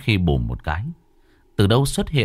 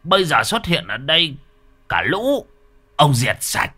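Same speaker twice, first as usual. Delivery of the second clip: much higher, louder blustering challenge; bravado; 9 semitones higher, 4 dB louder than usual. Acted. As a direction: puff up and bluster at the ghosts. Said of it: "lão vẫn ra oai".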